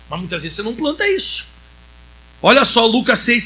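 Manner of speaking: normal talking voice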